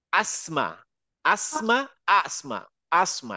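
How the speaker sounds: আসমা opening on a firm glottal আ, last vowel held; full hamza onset. Shown as a demonstration. Teacher gives an example, আসমা with full glottal আ. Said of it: "একদম পুরা".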